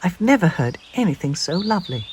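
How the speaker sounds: small bird voice. Acted. Peep voiced high.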